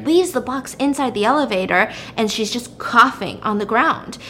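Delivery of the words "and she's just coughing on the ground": usual speaking voice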